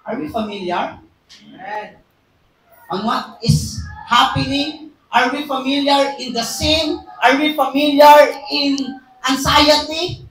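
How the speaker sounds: rising questions to the congregation; preaching. Acted. Preacher challenging the church on anxiety, rising questions. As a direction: press each question to the crowd.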